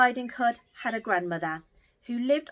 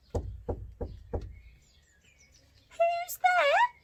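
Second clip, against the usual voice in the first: put-on old grandmother calling. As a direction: call out like a startled granny. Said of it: "cried a voice from inside".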